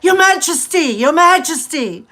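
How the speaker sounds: high, loud mock call to the King; retold yelling out. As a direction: replay calling the King across the street. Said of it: "I yelled out".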